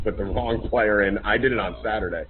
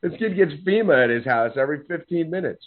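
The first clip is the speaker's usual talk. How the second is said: ordinary delivery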